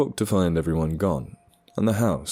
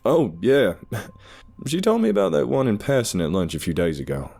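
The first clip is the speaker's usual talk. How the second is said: laughing; 6 semitones higher, 3 dB louder than usual. Acted. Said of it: "Ed laughed".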